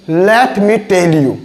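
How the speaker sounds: "Let me tell you" very slow, each word separate; slow Indian English. Shown as a demonstration. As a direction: spell it out slowly. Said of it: "जैसे मैंने एक sentence में अभी बोल रहा हूँ".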